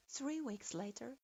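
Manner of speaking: ordinary delivery, nothing marked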